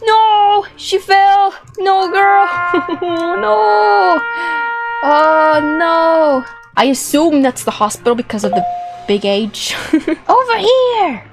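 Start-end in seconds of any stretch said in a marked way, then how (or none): none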